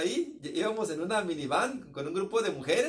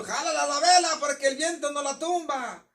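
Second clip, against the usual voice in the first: calling out; 7 semitones higher, 4 dB louder than usual. Retold or imitated. Sailors shouting in the storm.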